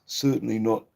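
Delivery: hacking cough; coughing fit. Real, not acted. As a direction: cough hard and long. Coughing fit mid-sentence.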